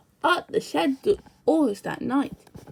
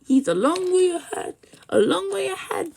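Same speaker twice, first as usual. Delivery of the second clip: wailing coaches; 7 semitones higher, 4 dB louder than usual. A narrator's reading. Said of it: "they wailed".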